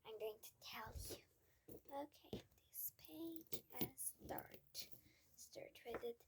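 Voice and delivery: hushed aside, barely voiced; whispering. Real, not acted. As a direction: whisper it so only one person hears. Whispering to a listener mid-reading.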